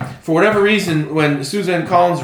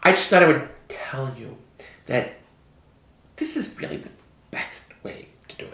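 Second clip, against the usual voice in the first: put-on soft, breathy Valley Girl voice; a hint of a Valley Girl; 4 dB quieter than usual. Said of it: "Why are you doing your Valley Girl voice?".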